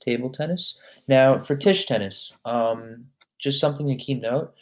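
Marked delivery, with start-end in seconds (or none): none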